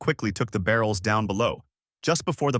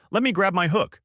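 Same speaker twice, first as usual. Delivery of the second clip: mock excited yell, pitched well up; 10 semitones higher than usual. A narrator's reading. Sailor offering to hoist the barrels, mildly yelled with excitement. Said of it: "The other excitedly yells out".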